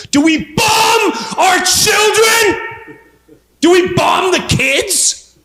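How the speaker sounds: high, drawn-out yelled question; mock-outraged shout; incredulous shouting. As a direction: cry it out high and slow in disbelief. Acted incredulous rhetorical question.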